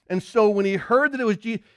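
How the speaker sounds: ordinary delivery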